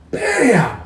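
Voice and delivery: high, sudden "bam" for a slam; imitated door slam. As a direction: punch out a slamming-door noise.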